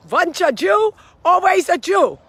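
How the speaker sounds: put-on loud, high Yiddish-meets-Dracula accent; over-the-top mock Yiddish accent; muddled Yiddish accent. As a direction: belt it out in a hammy, botched Yiddish accent. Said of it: "I don't know what accent that was".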